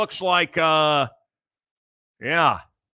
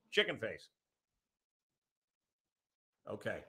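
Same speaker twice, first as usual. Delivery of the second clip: normal talking voice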